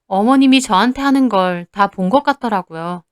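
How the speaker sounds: ordinary delivery, nothing marked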